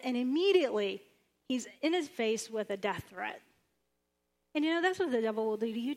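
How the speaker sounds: ordinary talk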